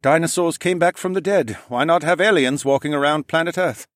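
high-pitched, frazzled man; overwhelmed engineer's high voice; harried French-accented engineer. A narrator's reading.

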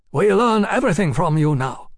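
put-on eager young Japanese envoy, fervent. A narrator's reading. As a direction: voice a keen young foreigner, earnestly.

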